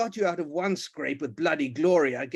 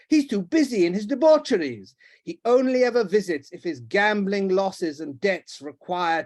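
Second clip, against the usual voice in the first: loud, higher accusation; angry rant; 5 semitones higher, 6 dB louder than usual. Acted. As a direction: accuse him furiously. The Duke ranting on.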